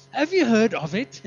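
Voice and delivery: loud, drawn-out mock British accent. Pastor asks the woman on the plane, loud British accent. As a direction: put on a slow, emphatic British accent. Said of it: "in the beautiful British accent".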